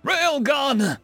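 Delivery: high, drawn-out battle cry; shouted spell. Acted. Mage unleashing a spell on the enemy crest, shouted. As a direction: call out the spell at full pitch. Casting an attack spell aloud.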